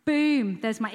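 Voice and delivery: loud, punchy exclamation; a big excited burst; triumphant boom. Real, not acted. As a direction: slam it down like a discovery. Sudden revelation in sermon.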